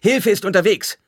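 very high, loud shout; calling out. A narrator's reading. Paul shouts to the blinded men that help comes, shouting. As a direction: shout news across a street. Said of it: "rief Paul".

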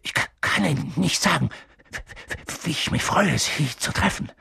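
nervous, halting stutter; jittery professor tripping on words; stammering. A narrator's reading. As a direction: stumble over first sounds as you greet someone. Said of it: "stammelte Professor Quirrell".

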